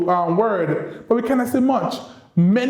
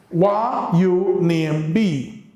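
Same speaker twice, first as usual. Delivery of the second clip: slow Kittitian Creole question, drawn out; much slower than usual. Shown as a demonstration. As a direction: model the Creole phrasing slowly for learners. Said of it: "we might say to each other".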